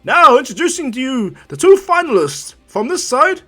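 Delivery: loud, high hype-man introduction; big arena ring-announcer voice; tournament announcer. Acted. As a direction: build to a long, drawn-out name shout.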